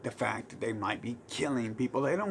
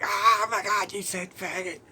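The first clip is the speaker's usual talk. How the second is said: high, loud mock-shocked whine; pearl-clutching, squeal it; offended gasp; 8 semitones higher, 6 dB louder than usual. Mocking offended people, a high shocked whine. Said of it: "people are like".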